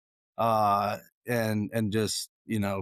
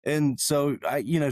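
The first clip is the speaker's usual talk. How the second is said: usual speaking voice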